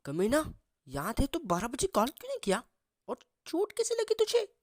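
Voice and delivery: excited, pitch climbing to a high squeak. A narrator's reading. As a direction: squeal out the questions to a friend. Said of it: "Ritu excited से देख के बोलती है".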